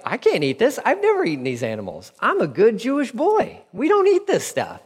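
high, pleading protest voice; a good boy refusing the food; protesting Peter. Retold or imitated. Peter objecting to God about unclean animals, high protest.

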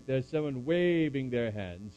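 ordinary talk, nothing marked

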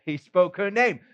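ordinary talk

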